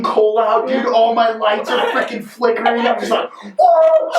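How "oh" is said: high, loud whoop of thrill; excited yell. Retold or imitated. Driver yelling as his truck spits smoke.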